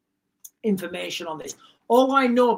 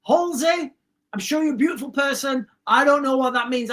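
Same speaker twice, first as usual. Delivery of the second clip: usual speaking voice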